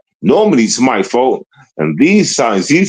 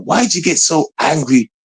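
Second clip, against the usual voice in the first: scolding himself; 6 semitones higher than usual. Retold or imitated. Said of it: "I say to myself all the time".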